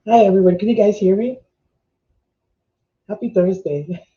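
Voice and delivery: ordinary delivery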